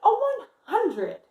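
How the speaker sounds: lively picture-book read-aloud voice; reading to children. Acted. Teacher reading Amelia's story to her class, lively.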